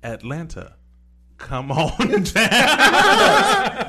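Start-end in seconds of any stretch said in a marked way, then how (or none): 1.7-3.9 slow, high, loud drawn-out call; laughing sing-song call. Pretend. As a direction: beckon through laughter. Calling Bronny to Atlanta.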